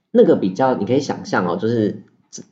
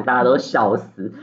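normal talking voice

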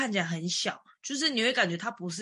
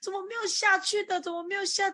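high, anxious searching for an exit; retold panic; 11 semitones higher than usual. Lost on the expressway, fretting high about the exit.

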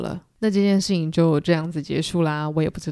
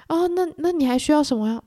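eager host offering; 9 semitones higher than usual. Retold or imitated. Gatsby eagerly offering drinks.

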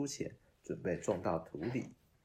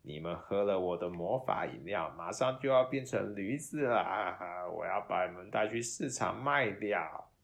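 gloating; 6 dB louder than usual. Acted. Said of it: "团长得意洋洋地说".

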